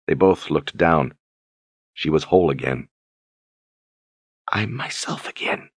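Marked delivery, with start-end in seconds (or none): 4.5-5.7 soft, hushed, relieved; whisper. A narrator's reading. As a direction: breathe it out quietly. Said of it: "she whispered".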